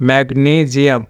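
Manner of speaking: ম্যাগনেসিয়াম with stressed long "nee", then "zi-am"; correct English pronunciation. Shown as a demonstration. Teacher reads the phonetic transcription, ম্যাগনেসিয়াম carefully pronounced mag-NEE-zi-am. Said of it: "এখানে phonetic transcription করেছি".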